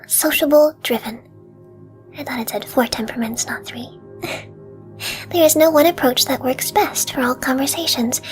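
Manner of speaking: ASMR whisper. Real, not acted. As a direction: whisper close to the mic. Whispers throughout the video.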